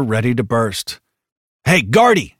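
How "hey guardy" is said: high, loud call to get attention; urgent raised hail; yell. A narrator's reading. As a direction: yell across to a comrade. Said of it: "Hicks yelled".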